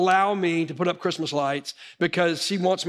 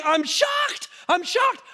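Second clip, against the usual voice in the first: mock shriek, much higher in pitch; imitating a scream; 14 semitones higher, slower than usual. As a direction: play the wife's panicked screaming. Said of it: "she starts screaming".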